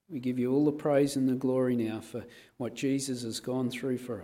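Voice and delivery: soft, hushed communion prayer. Acted. Pastor leading the congregation in prayer, softly. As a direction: pray gently over the cup.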